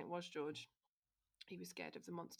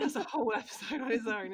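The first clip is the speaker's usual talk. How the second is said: laughing; 5 semitones higher, 15 dB louder than usual. Real, not acted. Laughing at the joke.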